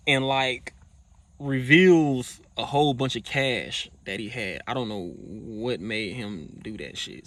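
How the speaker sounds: ordinary talk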